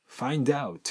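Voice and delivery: "find out" joined as fine-dout; English liaison, "find out" as one word; linked consonant and vowel. Shown as a demonstration. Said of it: "これが隣り合うと".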